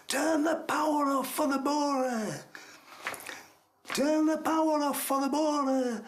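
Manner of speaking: imitated urgent holler, pitched high; retold shouting. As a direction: call it down a long tunnel. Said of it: "I'm shouting".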